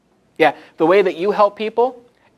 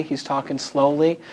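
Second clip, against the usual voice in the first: ordinary talk, nothing marked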